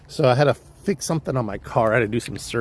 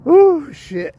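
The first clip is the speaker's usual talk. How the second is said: much higher and louder outburst; sudden exclamation; 8 dB louder than usual. Real, not acted. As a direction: blurt it out in shock.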